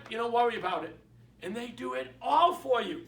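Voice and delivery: ordinary talk, nothing marked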